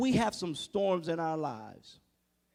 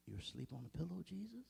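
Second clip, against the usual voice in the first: soft, rising disbelief; can't believe he's sleeping; incredulous echo; 3 semitones lower, 20 dB quieter, faster than usual. Pretend. Preacher marvels at Jesus asleep, softly incredulous.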